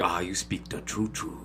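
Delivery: soft hushed made-up dialect; Cloud Atlas speech. Retold or imitated. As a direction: mimic the film's odd language quietly. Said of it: "that's what they talk like".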